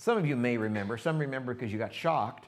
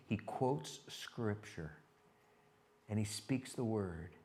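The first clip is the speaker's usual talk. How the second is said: hushed reverent; 2 semitones lower, 9 dB quieter, much slower than usual. Real, not acted. Reverent turn in the sermon.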